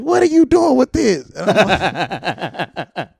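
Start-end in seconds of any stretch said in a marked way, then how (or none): 0.0-1.3 much higher, louder stern question; scolding teacher. Retold or imitated. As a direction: play the shocked teacher. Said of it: "my teacher".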